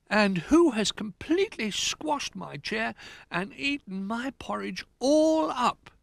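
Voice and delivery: slow, high little-bear voice; drawn-out high-pitched outrage; indignant Little Bear. Acted. Little Bear's porridge is all eaten, high indignation.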